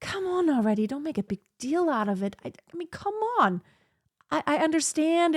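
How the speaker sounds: a light plea to drop it; impatient. Retold or imitated. Speaker urging a hurt person to move on, mildly impatient. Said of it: "from my perspective is like".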